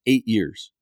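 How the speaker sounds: ordinary talk, nothing marked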